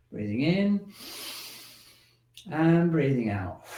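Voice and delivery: slow, soft breathing cues; guided breathing. Shown as a demonstration. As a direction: lead the breath gently, drawn out.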